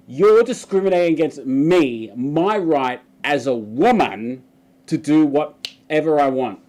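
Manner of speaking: loud whiny entitled rant; mock the customer's indignation; entitled Karen. Karen berating the store greeter, loud entitled whine. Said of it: "And she's just like".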